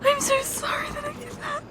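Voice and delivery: high, soft, tear-choked apology; weeping. Acted. Apologizing to her partner for the danger, weeping. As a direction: barely get the words out through weeping.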